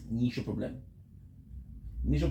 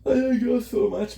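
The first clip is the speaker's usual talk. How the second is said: much higher, louder, gushing burst; gushing to chat; 8 semitones higher, 7 dB louder than usual. Real, not acted. Telling his viewers he loves them, high loud gush. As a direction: burst out with love for the audience.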